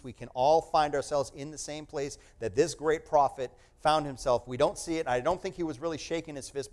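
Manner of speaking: usual speaking voice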